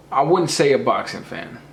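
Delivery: normal talking voice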